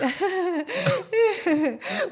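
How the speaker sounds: high, drawn-out breaking-up laugh; acted helpless laugh. Reacting to the duck alarm, high helpless laughter. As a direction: laugh high as the drama collapses. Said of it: "and I'm like".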